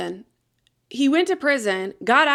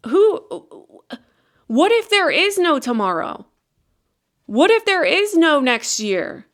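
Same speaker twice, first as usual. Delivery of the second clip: urgent; 5 semitones higher than usual. Real, not acted. Urgent rhetorical questions to viewers.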